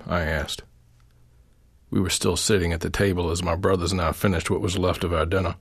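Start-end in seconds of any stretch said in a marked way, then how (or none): none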